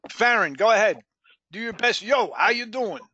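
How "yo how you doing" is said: put-on Brooklyn greeting, pitched up; Brooklyn accent. Shown as a demonstration. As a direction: show off a pretend Brooklyn accent. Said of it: "who could pretend they're from Brooklyn".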